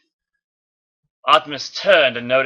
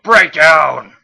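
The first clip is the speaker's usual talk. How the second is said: yell; 2 semitones higher, 9 dB louder than usual. Acted. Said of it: "I can't go louder with that voice".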